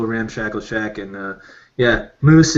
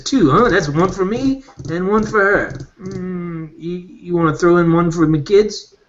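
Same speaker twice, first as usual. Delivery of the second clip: a lightly raised, higher haggling merchant; bargaining slyly, pitched up a little; shopkeeper voice; 5 semitones higher than usual. Acted. Shopkeeper haggles with the player over payment, lightly voiced higher. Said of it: "he says".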